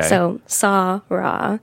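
slow Sarah with long open ah vowels; Finnish Sarah. Shown as a demonstration. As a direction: draw out Sarah as SAAH-rah, Finnish style. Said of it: "two A's at the beginning".

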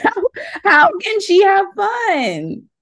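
high, half-laughing cry of disbelief; laughing exasperation. Real, not acted. Asking the guest how moms cope, half-laughing and exasperated. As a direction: laugh out the question in mock despair.

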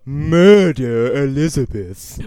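very slow, loud ominous threat; milk the villain line; hammed-up menacing monster; dramatic monster threat.

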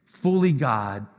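normal talking voice

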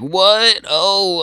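high, loud mock-panicked yelp; freaking out. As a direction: yelp in hammed-up alarm. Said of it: "he's still freaking out".

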